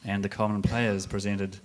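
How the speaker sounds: formal play announcement. Acted. Announces the play's start.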